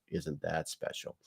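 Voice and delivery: very soft, slow sarcasm; sarcastic aside. Real, not acted. Host comments on the spokesperson's quote, sarcastic aside. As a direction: drop it low in volume, dryly sarcastic.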